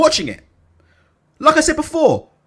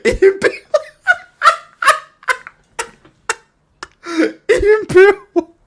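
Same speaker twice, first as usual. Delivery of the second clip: high, squeezed words through laughter; real fit of giggles; helpless laughing; 12 semitones higher, slower than usual. Real, not acted. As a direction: try to say the name while cracking up. Can't stop laughing at the name.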